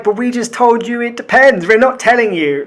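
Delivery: mock exasperated reply to critics; exasperated protest. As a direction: plead that you already said so. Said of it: "And we're like".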